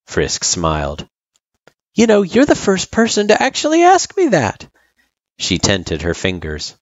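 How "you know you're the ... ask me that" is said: put-on high-pitched child character; friendly kid. A narrator's reading. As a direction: answer the old turtle as a kid, pitched up.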